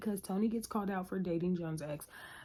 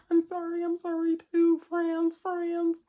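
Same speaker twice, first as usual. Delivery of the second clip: high, louder mock-sobbing make-up; hammed-up tearful apology; 9 semitones higher, 7 dB louder than usual. Two friends making up, hammed-up teary voices. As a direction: overplay the teary hug. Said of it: "they're just like".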